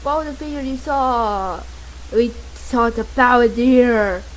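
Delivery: usual speaking voice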